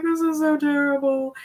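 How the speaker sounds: high, tearful wail in jest; mock ugly crying. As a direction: whine it like a hammed-up sob. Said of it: "did my ugly crying in there".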